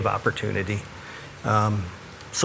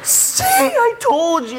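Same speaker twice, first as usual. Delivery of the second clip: much higher, slow mock wife voice; wife gloating; slower than usual. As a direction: squeak it out like the told-you-so wife. Wife teasing after curb scrape.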